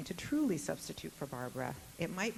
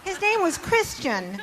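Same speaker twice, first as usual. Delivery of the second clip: laughing; 16 dB louder, slower than usual. Real, not acted. Teasing joke about the book.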